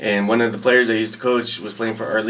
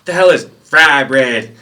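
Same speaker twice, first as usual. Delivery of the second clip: slow, loud puzzled bark; drill sergeant voice; 3 semitones higher, 9 dB louder, slower than usual. Retold or imitated. Drill sergeant puzzled by fry bread, slow loud bark. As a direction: bark out the strange word slowly.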